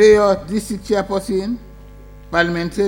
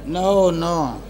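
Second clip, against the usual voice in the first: ordinary delivery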